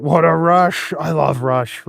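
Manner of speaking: ordinary talk, nothing marked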